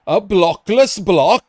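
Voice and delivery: loud, high, exaggerated mimicry of the accent; hammed-up copy of someone copying your accent; PC imitating streamer's accent. Streamer mimics PC doing his accent, loud exaggerated accent. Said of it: "in P C doing my accent".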